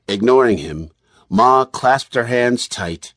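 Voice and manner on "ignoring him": ordinary delivery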